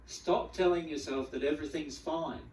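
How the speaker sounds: ordinary talk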